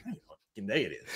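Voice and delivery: very soft, quick, low aside; hushed, hurried self-talk; muttered confusion. Retold or imitated. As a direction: mutter it fast under your breath. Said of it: "where I'm like".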